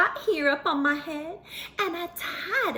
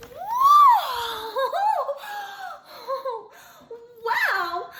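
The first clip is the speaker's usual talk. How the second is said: much higher, loud delighted shout; amazed whoop and laugh; 11 semitones higher, 6 dB louder than usual. A narrator's reading. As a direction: burst out in wonder. Transformation amazement.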